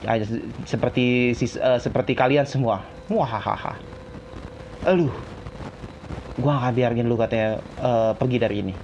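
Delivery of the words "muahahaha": soft villainous muahaha; gloating evil cackle; evil laugh. Acted. Villain gloating over the hero.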